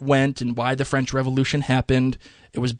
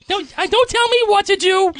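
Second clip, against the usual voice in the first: squeaky mock voice; 3 dB louder than usual. Mocking squeaky voice.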